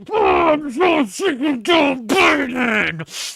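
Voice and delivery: "Oh, I'm so sick of Joe Biden" shouted slowly, high and loud, in fake crying; hammed-up crying rant, shouted high and slow; mock conservative meltdown. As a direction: bawl it like a sobbing conservative. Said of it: "That's your average conservative".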